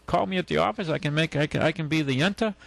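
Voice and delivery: usual speaking voice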